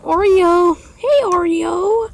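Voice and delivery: high, slow sing-song call of the name; calling the cat. Real, not acted. Calling the cat Oreo back to him, high slow pet call.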